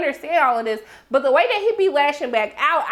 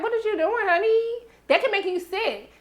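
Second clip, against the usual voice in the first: high mock fussing voice; 8 semitones higher than usual. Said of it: "She was like".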